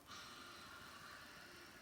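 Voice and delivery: a long, airy breath out through the mouth; exhale sigh. Shown as a demonstration. Yoga teacher showing the kids how to exhale, with a long audible sigh. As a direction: let the breath go audibly so the class can copy it. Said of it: "And when you exhale".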